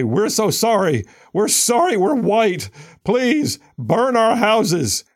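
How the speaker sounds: high, drawn-out mock apology; mock pleading apology.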